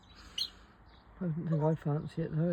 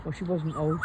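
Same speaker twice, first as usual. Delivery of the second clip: normal talking voice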